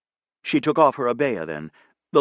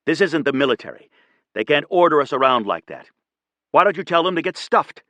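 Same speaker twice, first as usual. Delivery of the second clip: higher and louder, heated yell; shouted; 5 semitones higher, 3 dB louder than usual. A narrator's reading. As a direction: shout it at her, fed up. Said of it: "he shouted at her".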